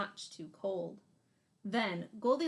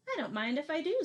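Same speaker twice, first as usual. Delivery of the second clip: lightly voiced cheeky girl, higher and quick; a quick, higher, cheeky little girl; Goldilocks; 6 semitones higher, 4 dB louder, faster than usual. Goldilocks helps herself to the biggest bowl, lightly voiced cheeky girl. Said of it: "said Goldilocks".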